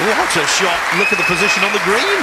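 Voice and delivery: loud, high thrilled commentary; excited. Real, not acted. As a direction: marvel at a brilliant shot.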